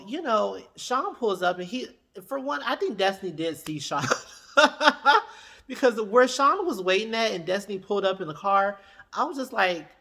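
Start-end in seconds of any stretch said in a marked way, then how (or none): 4.1-5.5 sudden loud high burst of laughter; real laugh breaking out. Real, not acted. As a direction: crack up out loud. Laughs about Sean's parking.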